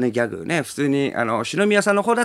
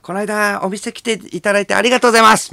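a louder, indiscreet thank-you; loud tout greeting; 7 semitones higher, 6 dB louder than usual. Retold or imitated. Catch man loudly thanking the comedian in the street. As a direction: call it out too loudly for the street. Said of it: "結構大きな声で言うな".

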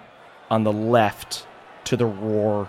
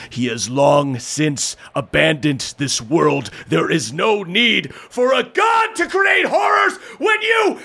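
defiant ogre voice; 3 semitones higher, slower than usual. Acted. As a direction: start grave and defiant, end roaring at the crowd. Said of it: "The ogre looks up and speaks".

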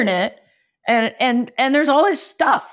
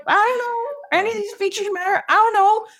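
high, helpless whine; mock bewildered customer; pitched-up overwhelmed questions; confused buyers; 8 semitones higher than usual. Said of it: "And they're like".

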